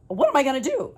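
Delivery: high, loud panicked question; frantic. Retold or imitated. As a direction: voice shooting up in alarm. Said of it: "like, frantic".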